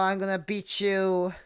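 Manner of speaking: high, loud playful threat; mock threat. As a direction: shout it as a cat picking a fight. Cat threatens someone in game.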